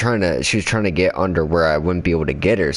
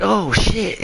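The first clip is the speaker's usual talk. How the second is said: retold startled yell. Retelling how they found the snake loose, high mock yell. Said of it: "I fucking yelled".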